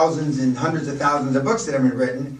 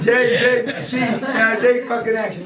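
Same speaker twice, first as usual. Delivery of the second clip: imitating Scottish accent; 7 semitones higher, slower than usual. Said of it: "how do you say it in Scottish".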